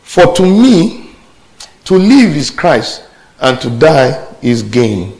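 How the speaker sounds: slow, emphatic Bible reading; scripture reading. Real, not acted. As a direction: read the verse out slowly, weighting each phrase.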